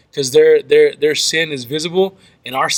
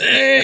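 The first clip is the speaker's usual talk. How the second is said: sheepish eh; 10 semitones higher than usual. Retold or imitated. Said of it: "I was like".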